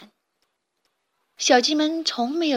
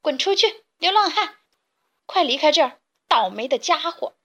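angry shout; 5 semitones higher than usual. Acted. Chicks yell at the cat to get out, angry and high. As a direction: drive the cat away angrily. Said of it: "生气地对他喊".

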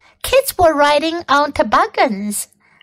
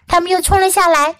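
ordinary delivery, nothing marked

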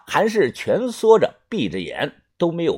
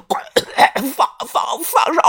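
strangled, sputtering plea with a cough; choking stammer that rises high at the end; being choked; 7 semitones higher than usual. A narrator's reading. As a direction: force words out through a squeezed throat.

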